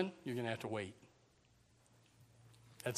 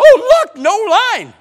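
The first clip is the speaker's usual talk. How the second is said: much higher, loud delighted gasp; excited exclaim; 16 dB louder, much slower than usual. Retold or imitated. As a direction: squeal over the empty line. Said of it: "Somebody says".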